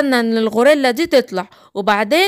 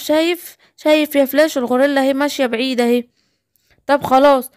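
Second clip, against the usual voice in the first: ordinary delivery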